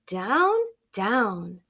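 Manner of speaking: usual speaking voice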